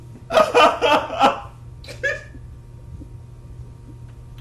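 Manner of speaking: helpless high laugh; long cracking-up laugh at own joke. Real, not acted. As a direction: burst out laughing, trailing into breathless giggles.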